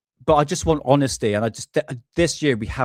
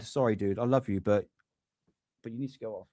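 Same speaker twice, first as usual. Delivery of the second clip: soft to dog; 7 dB quieter than usual. Real, not acted. Shooing the dog away.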